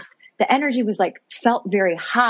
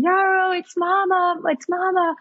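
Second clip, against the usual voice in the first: high, slow motherly call; calling to baby; 10 semitones higher than usual. Retold or imitated. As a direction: call softheartedly across to your newborn. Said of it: "calling to her".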